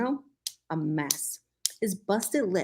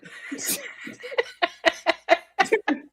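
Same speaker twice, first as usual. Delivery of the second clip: breathy giggling burst; high breathy giggle; 14 semitones higher than usual. Real, not acted. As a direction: laugh it out, whispery. Laughing at herself.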